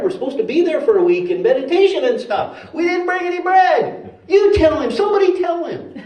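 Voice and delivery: high-pitched, hammed-up bickering; mock argument climbing high; heated squabble. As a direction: argue in a rising, panicky pitch. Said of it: "it's getting heated".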